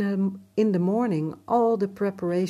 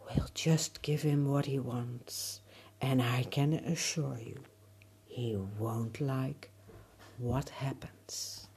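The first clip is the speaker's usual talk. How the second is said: ominous sky voice; 3 semitones lower, 4 dB quieter than usual. Acted. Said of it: "And the sky said".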